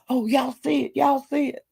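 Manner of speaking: high, soft mock excitement; hushed and way up high, pointing at the rat; imitating Brad. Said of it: "he was saying".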